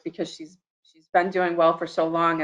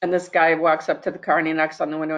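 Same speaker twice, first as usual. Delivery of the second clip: usual speaking voice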